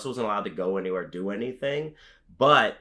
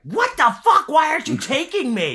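put-on much higher, louder, outraged kid whine; imagined kid outrage; 6 dB louder than usual. Host imagining his younger self begging dad for wrestling, outraged high squeal. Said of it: "I'd be like".

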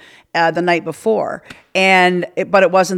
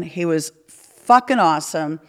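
ordinary talk, nothing marked

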